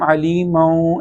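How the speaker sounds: علیمو with the n melted into a nasal oo glide; wrongly merged ending. Shown as a demonstration. Teacher shows wrong merging, علیمو gliding off without its n. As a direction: blur the end of علیمو into a nasal vowel, no clear n. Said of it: "اس طرح کر کے ملانے کی".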